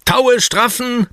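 high, loud command to the crew; high-pitched bellowing; bellowed order. A narrator's reading. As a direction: shout it out like a pirate at the ropes. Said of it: "brüllt Oliver".

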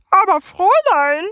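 very high, loud croaking female toad. A narrator's reading. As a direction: croak it like a prim toad lady. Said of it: "quakte die Kröte mit einer weiblichen Stimme".

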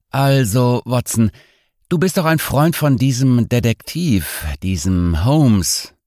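a hint of a tipsy, teasing host; tipsy nerve doctor. Same doctor character continues.

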